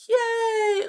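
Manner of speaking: high, loud, delighted yay; happy cheer. Real, not acted. Streamer cheers a viewer who is doing okay, happy cheer. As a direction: cheer brightly for good news.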